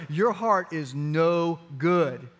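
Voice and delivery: each word spelled out slowly; slow blunt verdict. Retold or imitated. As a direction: lay it down word by word, no softening. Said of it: "I'm just like".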